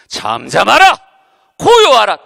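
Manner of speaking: loud, forceful command to the storm; commanding rebuke. Retold or imitated. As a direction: rebuke the wind with full authority. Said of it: "바람을 꾸짖으시며". (바람을 꾸짖는 힘찬 명령)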